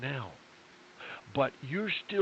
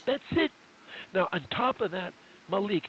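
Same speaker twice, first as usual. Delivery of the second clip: usual speaking voice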